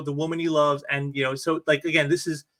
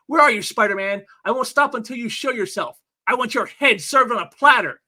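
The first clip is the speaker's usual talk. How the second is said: raised, hammed-up supervillain demand; villain's angry challenge; 6 semitones higher, 4 dB louder than usual. Hobgoblin causing havoc, calling out Spider-Man, hammed-up angry challenge. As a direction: call out the hero, higher and forceful.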